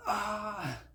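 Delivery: high frustrated yell as it's ripped; frustrated cry. Retold or imitated. Upset child tearing up his art project, crying out. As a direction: let out a sudden anguished cry. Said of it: "I said".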